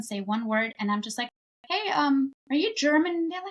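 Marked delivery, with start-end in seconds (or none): 1.7-3.1 bright, higher asking voice; curious question. Retold or imitated. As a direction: playfully ask a stranger. Acted asking someone if German.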